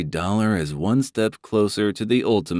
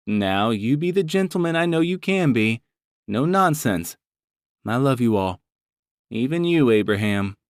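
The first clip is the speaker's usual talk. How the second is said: higher motherly voice, a little teasing; Mama voice; 5 semitones higher than usual. Acted. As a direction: play the doting mother chiding her son. Mama's parting words.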